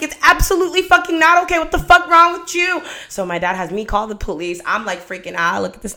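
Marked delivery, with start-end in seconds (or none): 0.0-2.8 much higher, louder, fast shouting; furious yell. Retold or imitated. Dad screams at the ex hiding in the car, furious. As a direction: blast him with rage.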